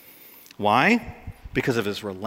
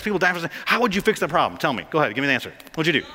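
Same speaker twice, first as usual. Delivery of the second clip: normal talking voice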